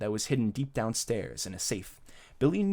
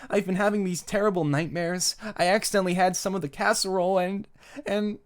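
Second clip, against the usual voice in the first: put-on higher, mildly tearful boy voice; 7 semitones higher than usual. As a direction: plead haltingly, a touch of tears. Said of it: "Billy's eyes were filled with tears".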